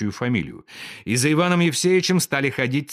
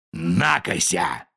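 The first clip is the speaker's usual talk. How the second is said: lower, slow scornful jeer; contempt; 6 semitones lower, slower than usual. A narrator's reading. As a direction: sneer at him. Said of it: "сказал генерал с презрением".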